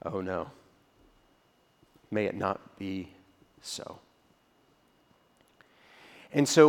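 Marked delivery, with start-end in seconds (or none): 0.0-4.0 low, soft, fading "may it not be so"; quiet dismay. Retold or imitated. Paul answering the church in Rome, soft, low and fading. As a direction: let it die away in dread. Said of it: "And he's saying".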